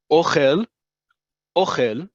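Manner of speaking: "Ochel" stressed on the first syllable, OH-khel. Shown as a demonstration. Teacher contrasts food with eats, stress on OH in "Ochel". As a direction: punch the O of "Ochel", let khel stay light. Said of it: "emphasis on the first syllable".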